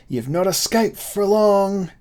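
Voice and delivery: high, slow villain's cry; brigand threat. A narrator's reading. Pursuer threatening von Beck, crying like a brigand. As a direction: cry it like a brigand in an old tale. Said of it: "cried he, like a brigand".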